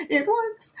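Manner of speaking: high gleeful it works; delighted. Real, not acted. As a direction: say it with glee.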